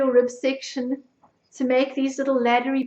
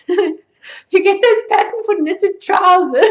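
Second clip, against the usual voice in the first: giggly, higher-pitched and slow; laughing while talking; 5 semitones higher, 4 dB louder, slower than usual. Real, not acted. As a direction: squeeze the words out through giggles. Giggling at the trousers idea.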